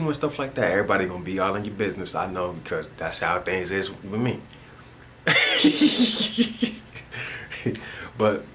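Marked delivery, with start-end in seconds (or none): 5.2-8.1 high breathy giggling fit; high-pitched laugh. Real, not acted. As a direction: laugh high and wheezy, tapering off. Laughs at own situation.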